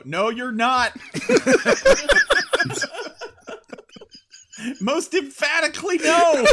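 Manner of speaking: high laughing denial. Real, not acted. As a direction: laugh through the no. Laughing emphatic rebuttal.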